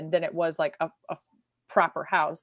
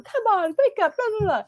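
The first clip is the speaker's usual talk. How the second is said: mock cheery wake-up call; 13 semitones higher than usual. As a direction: chirp it out like an overexcited little brother. Brother still nagging her awake.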